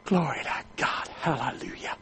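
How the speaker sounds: awed praise under the breath; whispered praise. Real, not acted. Preacher awed by the answered prayer, whispering praise. Overwhelmed praise after the story.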